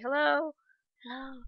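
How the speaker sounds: a voice heard from far away, faint; hello drifting in from a distance. Retold or imitated. Recalling a host's greeting heard through her daze, faint and distant. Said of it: "fading in instead of fading out".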